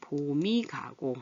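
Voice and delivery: 봄이 said slowly as "보-미"; slow demo of 봄이 sounded as "보미"; linked pronunciation. Shown as a demonstration. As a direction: stretch it out, sliding ㅁ onto 이: "보-미 가고". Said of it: "이렇게 소리가 나죠".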